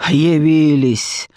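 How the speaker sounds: slow, smug, stretched word; sneering, drawn-out greeting; drawling with a smirk. A narrator's reading. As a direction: draw it out through a smirk. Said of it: "процедил он с ухмылкой".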